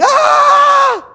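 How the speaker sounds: very high, loud, long scream; imitated shriek of agony; piercing shriek. Shown as a demonstration. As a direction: scream a sustained "ah". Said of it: "dengan kata menjerit".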